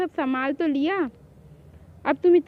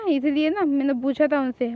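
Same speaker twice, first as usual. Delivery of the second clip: ordinary talk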